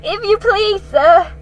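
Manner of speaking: high, loud frightened plea; a scared boy barely getting it out; trembling boy. Acted. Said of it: "trembling so that he could hardly speak".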